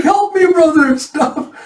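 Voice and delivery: very high, squealed plea; hammed-up helpless wail, trailing off; mock cry for help. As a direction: beg your brother to save you. Taejun begs brother for help.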